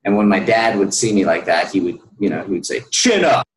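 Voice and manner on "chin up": sharp, loud and high barked order; aggressive command. Retold or imitated. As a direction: snap it at your slumped son like a drill sergeant. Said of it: "real aggressive".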